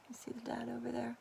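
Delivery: fast, unvoiced whisper; fast whispered aside to the listeners. Real, not acted. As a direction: lean in and whisper it.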